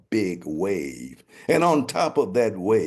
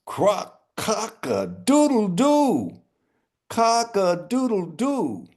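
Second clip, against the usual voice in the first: high, slow ringing crow; triumphant crow; 10 semitones higher, 4 dB louder, slower than usual. Acted. Rooster crowing victory over the dead king, high and slow. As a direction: proclaim it from the palace roof.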